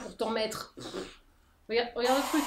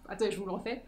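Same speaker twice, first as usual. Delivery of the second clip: ordinary talk, nothing marked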